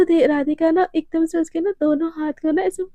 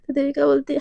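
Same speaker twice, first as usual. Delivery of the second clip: usual speaking voice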